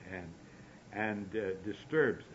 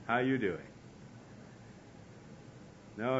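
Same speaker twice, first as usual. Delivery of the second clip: ordinary talk, nothing marked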